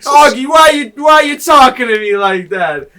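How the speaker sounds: put-on loud, high, offended drunk voice; hurt drunk cop. Drunk cop scolded by his colleague, loud whine. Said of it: "he's like".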